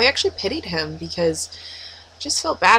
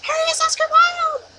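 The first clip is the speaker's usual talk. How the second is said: very high squeal of "Harry is Oscar Wilde!"; excited fan squeal. Retold or imitated. Vlogger in the corner screaming about Harry, squealing. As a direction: scream it with delight. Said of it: "me in the corner just like".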